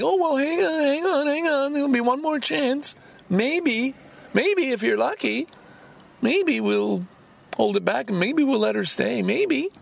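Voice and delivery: hammed-up high mock voice stringing them along. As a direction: mimic a dithering church official, pitched well up. Mocking United Church promises.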